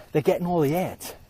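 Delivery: ordinary delivery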